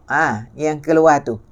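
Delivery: ordinary talk, nothing marked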